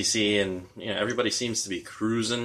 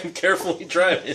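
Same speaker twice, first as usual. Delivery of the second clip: mock drunk driver; 8 semitones higher than usual. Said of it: "where it's like".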